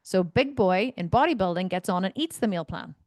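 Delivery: ordinary talk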